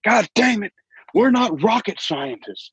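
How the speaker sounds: mock dad complaining; hammed-up fed-up father; imitating dad. Dad quoted yelling in story.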